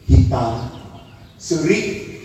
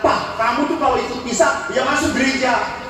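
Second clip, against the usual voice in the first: higher-pitched mock scolding; angry wife; 7 semitones higher than usual. Wife scolds husband for skipping Mass, mock angry. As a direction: imitate a wife nagging her husband. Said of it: "Istrinya tuh sudah marah".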